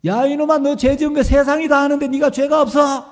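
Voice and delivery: high, loud scolding shout; thundering rebuke. Retold or imitated. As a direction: thunder at the boy. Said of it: "막 강하게".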